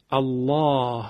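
الله with a thick, heavy L and dark open 'aa'; heavy lam. Shown as a demonstration. Quran teacher showing the lam after fatha, heavy lam. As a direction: say الله full-mouthed, the L thickened from the throat.

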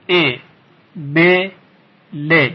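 "ا، ب، ل" as separate short "e, be, le"; three kasra syllables with a clear pause between; split into syllables. Shown as a demonstration. Teacher breaks up a word for learners, syllable by syllable.

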